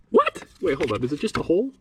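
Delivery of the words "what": sudden very high disbelieving "What?"; shocked squeak. Real, not acted. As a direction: jump way up in pitch, stunned. Finds a bare hole behind panel.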